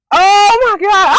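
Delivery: slow, high, loud cry; drawn-out frightened yell; panicked shout. Real, not acted. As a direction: wail it in real fright. Panicking over the tarantula.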